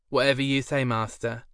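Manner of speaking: light lisp, meek servant; lisping reply. A narrator's reading. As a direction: answer the master sweetly. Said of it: "replied with a lisp".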